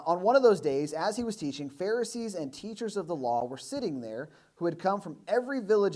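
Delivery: loud, measured Bible reading; scripture reading. Acted. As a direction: read the passage out to the church. Reads scripture passage aloud.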